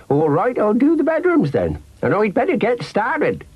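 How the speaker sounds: high-pitched, amiable rustic housekeeper; Gran Rowty character voice. Acted. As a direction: play an obliging gran, pitched well up.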